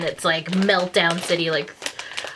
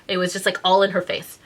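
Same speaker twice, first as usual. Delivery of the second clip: ordinary delivery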